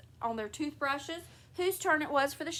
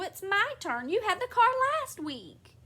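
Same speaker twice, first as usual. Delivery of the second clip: high, louder protest; petulant quarrelling; 8 semitones higher, 4 dB louder than usual. A narrator's reading. Husband arguing with wife over car, petulant protest. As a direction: protest with rising childish indignation.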